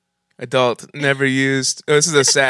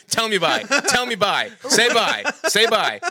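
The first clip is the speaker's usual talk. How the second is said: imitating a guy; 8 semitones higher than usual.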